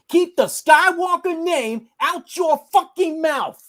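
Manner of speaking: high, slow yell, each word hammered; imagined shout. Retold or imitated. Friend imagines yelling at Rey in the theater, slow furious shout. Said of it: "I would've shouted".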